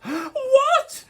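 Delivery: very high, loud shocked cry. Acted. Little Red crying out in shock at the wolf, very high. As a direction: shriek out the question in shock. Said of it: "cried Little Red".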